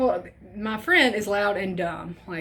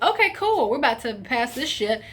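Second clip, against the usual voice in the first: cocky excitement; 3 semitones higher than usual. Retold or imitated. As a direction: sell it like you've got this. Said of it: "I was like".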